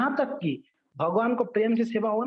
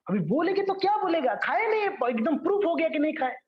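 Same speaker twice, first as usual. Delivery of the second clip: panicky; 10 semitones higher than usual. Retold or imitated. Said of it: "बेचारा पंडा तो घबरा गया".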